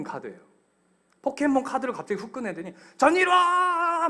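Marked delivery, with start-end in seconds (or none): 3.0-4.1 high, loud, slow drawn-out call; kid's call. Retold or imitated. Boy luring the son with a Pokémon card. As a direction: show off a card with a shout. Kid calling card name.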